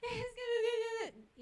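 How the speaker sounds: high whiny blubbering; crying child. Acted. Child failing to explain to mother, mock crying. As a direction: whine it high, unable to explain. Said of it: "llega el niño llorando".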